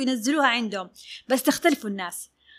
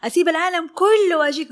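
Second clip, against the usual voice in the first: raised, louder mock complaint; imitated sharp client; 5 semitones higher, 5 dB louder than usual. Sharp client pressing her favourite dressmaker, loud imitated insistence. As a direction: play the forceful client pressing her point. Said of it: "هي أسلوبها حاد".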